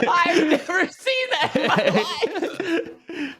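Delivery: laughing. Real, not acted. Laughing hard after clip.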